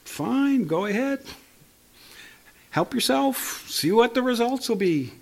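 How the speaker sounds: high, shrugging "fine, go ahead" voice; a lightly voiced, resigned Lord; God speaking. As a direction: voice God giving in with a dismissive shrug. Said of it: "it's like the Lord saying".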